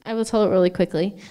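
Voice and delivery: ordinary talk, nothing marked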